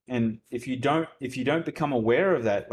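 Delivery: usual speaking voice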